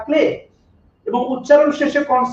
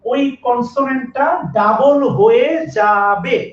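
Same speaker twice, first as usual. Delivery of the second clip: stretched-out teaching emphasis; slow, deliberate rule-stating; slow, emphatic; slower than usual. Real, not acted. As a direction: draw out each word to drive the rule home. Teacher hammers home the rule.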